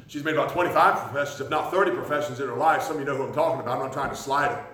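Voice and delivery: ordinary talk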